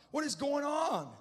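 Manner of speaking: high, loud, drawn-out cry of bewilderment; acted anguished outcry; crying out to God. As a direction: shout the desperate question slowly upward. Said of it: "I'm just crying out".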